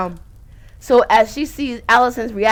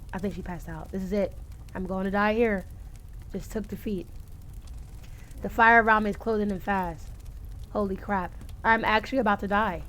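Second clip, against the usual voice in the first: faint despair; 3 semitones lower, 8 dB quieter than usual. Acted. Character giving up, fading.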